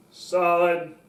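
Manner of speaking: deep-set, steady authority; low and solid. Shown as a demonstration. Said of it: "that's how it works".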